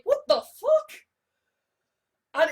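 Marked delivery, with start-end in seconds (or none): 0.0-1.0 a sudden very high, louder shriek of shock. Retold or imitated. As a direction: squeak it out in disbelief. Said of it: "he's like".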